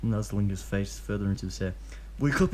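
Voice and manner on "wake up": lightly voiced Obito. A narrator's reading. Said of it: "he says".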